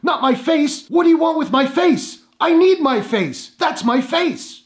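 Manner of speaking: mock pleading, high and slow; hammed-up movie victim. Mocking a bad horror scene, hammed-up pleading. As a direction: parody a bad actress begging for her face. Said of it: "There are scenes like".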